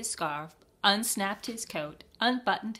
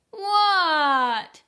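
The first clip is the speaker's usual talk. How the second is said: high, loud drawn-out shout back; yelling; 10 semitones higher, 10 dB louder than usual. A narrator's reading. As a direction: frog yelling back from the snow. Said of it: "yelled Froggy".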